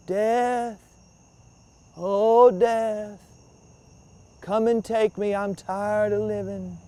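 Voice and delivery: thin, feeble call of a sick man; sickly weak voice. Acted. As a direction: call out weakly, like you can barely breathe. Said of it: "in his sickly, weak voice".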